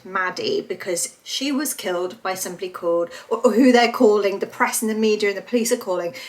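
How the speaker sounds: ordinary talk, nothing marked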